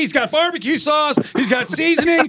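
very high, hyped listing that builds; excited shouting. Real, not acted. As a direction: shout the big reveal, worked up. Host excitedly teases Mark, building to shout.

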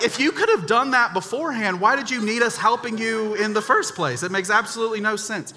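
high, loud, indignant retort; exasperated protest. Retold or imitated. Son to dad after he carried the washer alone, exasperated protest.

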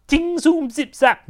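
high, zingy cartoon sound effects; comic-book zips and zaps; imitated ricochets. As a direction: fire off high-pitched bouncing noises. Imitates the shield bouncing round.

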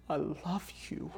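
breathy, slow, intimate whisper; tender whisper. Retold or imitated. Wife whispering love to her husband Peter, softly. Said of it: "do you look at him and you're like".

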